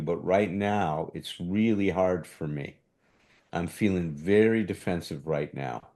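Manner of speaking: gently name your defensiveness to your partner; calm, vulnerable admission, not defending; calm honest description. Shown as a demonstration. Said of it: "I can describe and tell her".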